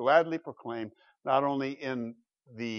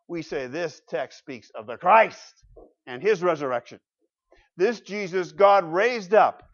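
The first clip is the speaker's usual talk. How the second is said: raised voice, building to a loud proclamation; emphatic preaching; 5 semitones higher, 7 dB louder than usual. Real, not acted. Preacher declaring we are all witnesses, emphatic preaching. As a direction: proclaim it with conviction to the congregation.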